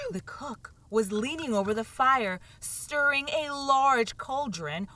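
ordinary delivery

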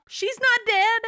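high gasping reveal, she's alive; excited disbelief. Real, not acted. Telling a friend the widow lives, high and excited. Retelling shocking funeral twist.

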